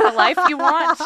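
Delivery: words breaking into a laugh; giggling as she finishes. Real, not acted. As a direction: laugh through the end of the line. Laughing at her own description.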